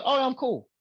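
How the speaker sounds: high-pitched, dismissive reassurance; imitating a friend who claims to be fine; breezy brush-off. Voices friend brushing off concern.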